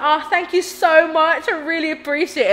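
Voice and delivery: kill them with exaggerated politeness; sarcastic thanks. Answering school bullies who call her fat with fake sweet thanks. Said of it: "So I was like".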